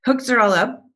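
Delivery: normal talking voice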